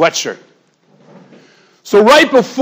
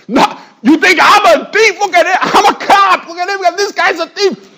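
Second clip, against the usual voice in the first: frantic shouting; 12 semitones higher, 3 dB louder than usual. Retold or imitated. Thief insists to police.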